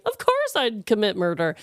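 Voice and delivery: ordinary talk, nothing marked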